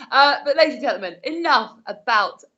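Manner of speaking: ordinary talk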